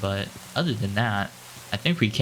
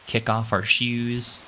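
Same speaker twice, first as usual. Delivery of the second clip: slow drawn-out Southern twang on "shoes"; a lightly Southern "shoes", stretched slow; Southern accent; much slower than usual. Real, not acted. Lightly Southern drawl while building a house, narrating to viewers. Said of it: "I like how I said shoes with, like, an accent, like I'm from the South".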